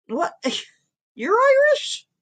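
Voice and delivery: squealed high "You're Irish?" through a laugh; mock shock. Teasing Irish chat, she squeals in fake shock. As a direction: shriek it in fake disbelief with a giggle. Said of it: "you're sitting there going".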